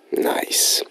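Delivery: whispered aside to listeners. Real, not acted. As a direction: whisper it, confiding. Host drops to whisper.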